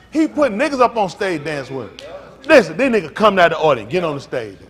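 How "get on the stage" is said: ordinary talk, nothing marked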